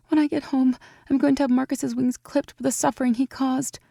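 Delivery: put-on high, anxious young woman; young woman, worried. A narrator's reading. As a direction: fret over her friend. Evie voice.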